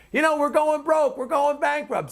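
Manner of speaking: high, mocking doom-saying; lightly voiced alarmist. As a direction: parody a pundit crying ruin on air. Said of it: "says to, uh, Neil Cavuto on air".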